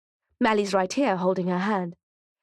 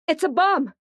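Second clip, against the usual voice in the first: higher, louder alarm shout; 9 semitones higher, 3 dB louder than usual. A narrator's reading. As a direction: yell the warning to the crowd. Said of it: "shouts someone".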